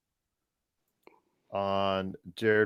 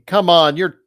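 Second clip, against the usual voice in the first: yelling from the sidelines; 5 semitones higher, 9 dB louder than usual. Real, not acted. Yelling at player on TV.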